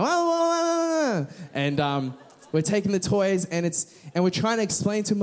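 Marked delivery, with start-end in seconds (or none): 0.0-1.3 hammed-up toddler bawling; imitated baby wail. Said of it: "he's freaking out".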